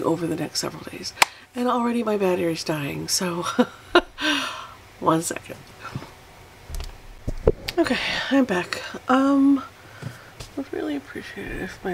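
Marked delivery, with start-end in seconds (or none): none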